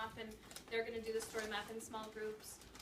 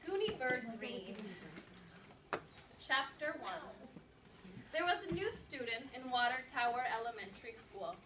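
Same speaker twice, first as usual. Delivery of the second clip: lively read-aloud narration for a class; story read-aloud; 6 dB louder than usual. Acted. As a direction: read the chapter to second graders with energy. Reading a chapter book aloud.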